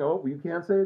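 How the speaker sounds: normal talking voice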